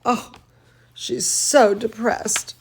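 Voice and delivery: ordinary delivery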